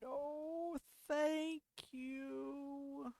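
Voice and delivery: put-on high, soft girlish voice; girl character voice. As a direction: answer sweetly in a high voice. Character voice line.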